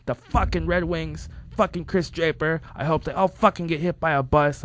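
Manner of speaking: high-pitched, raging rant imitation; imitated angry tirade. Friend ranting about the Red Wings, mock rage. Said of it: "He'd just go on these tirades".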